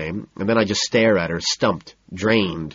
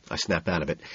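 ordinary delivery, nothing marked

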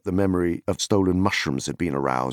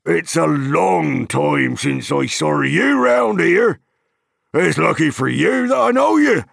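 big, high, loud farmer voice, settling as he goes; hearty hobbit farmer; 9 semitones higher, 6 dB louder than usual. Acted. Farmer welcoming Pippin and warning of strangers, hearty farmer voice. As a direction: hail an old acquaintance heartily, then chat on.

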